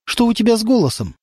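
worried mother. A narrator's reading. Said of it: "почувствовала тревогу".

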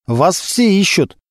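slow, high-pitched announcement to the captain; calling out. A narrator's reading. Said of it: "громко крикнул старшина Стеценко". (протяжный высокий оклик)

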